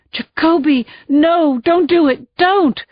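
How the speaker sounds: high, loud pleading shout rising; urgent shout. Acted. Sergeant shouting at Jacoby not to tase, urgent. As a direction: stop your partner before he fires. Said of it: "I shouted".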